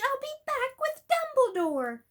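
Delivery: high, loud call as he runs; urgent call. Acted. Harry to Crumb, a high urgent shout. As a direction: shout it over your shoulder.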